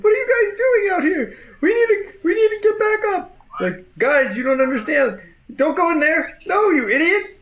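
high, fast warning from a panicked guard; frantic guard. Acted. Guard begs his comrades not to enter, high and frantic. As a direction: squeak out the warning, desperate.

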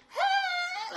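very high-pitched fake greeting; feigned innocence. Girlfriend greeting the man who caught her leaving, feigned innocence. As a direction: squeal a hello as if nothing is up. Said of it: "Like, she didn't just see him".

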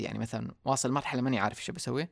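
ordinary talk, nothing marked

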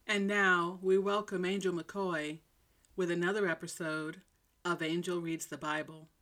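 normal talking voice

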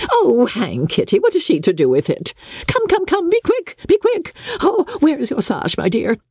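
high, hurried fussing mother; fussy mother. A narrator's reading. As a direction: chivvy the girl along in a high flustered voice. Mrs Bennet voiced in story.

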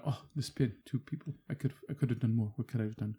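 soft, hushed, regretful mock lament; imitating Liam Neeson. Retelling Schindler's ending, soft mock Neeson grief. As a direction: quietly mourn what you failed to do. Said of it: "Liam Neeson does the".

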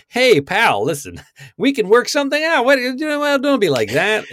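high, fast, wheedling fast-talker; hammed-up New York hustler bargaining; smooth-talking bounty. Blue alien bounty pleading with hunter, high and wheedling. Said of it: "He's like".

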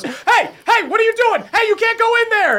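high-pitched, indignant challenge; flustered guard, voice jumped way up; alarmed guard. Acted. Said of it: "guy on top of the stump goes".

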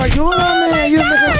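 very high mock-desperate shriek; comic high-pitched wail of a victim; imitated screaming for help. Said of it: "y gritando".

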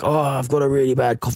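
sick-day caller. Acted. Co-host mimics calling in sick for work, putting on a poorly voice. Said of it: "when you're calling sick for work".

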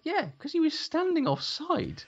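very high-pitched, drawn-out protest; incredulous retort. Retold or imitated. Answering the referee about a player standing offside, incredulous retort. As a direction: stretch it out in a high, indignant retort.

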